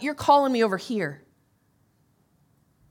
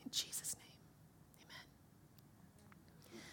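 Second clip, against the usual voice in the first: whispered amen; 28 dB quieter, much slower than usual. Real, not acted. Whispered close of prayer.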